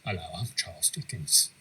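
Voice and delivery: low-pitched attempt at a British accent; Charles Dickens impression, British accent. Friend retrying Dickens for the host, low British accent. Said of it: "You can try it again".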